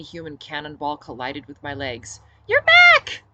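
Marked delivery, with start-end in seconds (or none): 2.5-3.2 put-on very high, loud delighted little girl; excited child. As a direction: squeal with joy at his return. Little girl greets captain.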